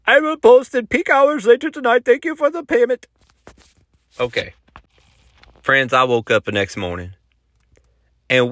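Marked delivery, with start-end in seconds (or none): 0.0-3.1 high-pitched put-on salesman voice; high, strained character voice, stretched at the end; tech guy Sushel. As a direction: pitch it way up as the Instagram promoter. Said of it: "Sushel with the message".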